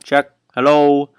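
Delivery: calling out. Pretend. Hailing Pluto from Mars, loudly calling out. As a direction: call out as if hailing a distant planet.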